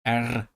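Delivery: vowel gliding into a rolled R; trilled R. Shown as a demonstration. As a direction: roll each "R" on the tongue. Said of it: "from a vowel to the trill".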